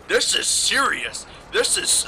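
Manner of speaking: loud, high, slow scolding adult; stern scolding. Retold or imitated. Officer telling the boys it is serious, loud slow scolding. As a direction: hammer each word slowly and loudly like an angry cop.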